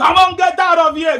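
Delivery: loud, high yell to get out; furious shout. Real, not acted. Host screaming at a caller to get out, furious. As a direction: bark him off the line.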